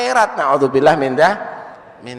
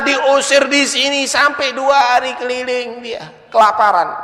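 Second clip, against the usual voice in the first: raised, loud storytelling sermon voice; preaching at full force; 8 semitones higher, 5 dB louder than usual. Acted. (ceramah berapi-api dengan suara tinggi dan keras)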